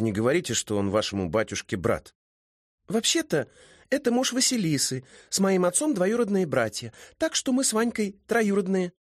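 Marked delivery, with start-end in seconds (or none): 2.9-8.9 put-on high, lively girlish character voice; high-voiced, sociable young mermaid; chatty young woman. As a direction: play a chatty girl, pitched up.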